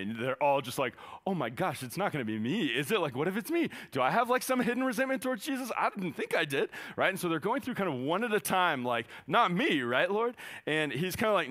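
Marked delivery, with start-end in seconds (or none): 1.3-6.7 high, worried, rushing questions; anxious disciples. Acted. Disciples panicking, voiced by preacher. 9.3-10.4 high, louder, nervous question; anxious plea. Acted. Disciples pleading one at a time.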